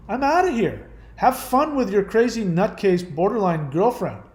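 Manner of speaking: acted, high-pitched fed-up confrontation; indignant telling-off. Host modelling a reply to a hurtful friend, indignant. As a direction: lay down the law to a bad friend.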